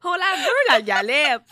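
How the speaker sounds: loud excited chant; too much caffeine. Real, not acted. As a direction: cheer it out, giddy. Joining the galette chant.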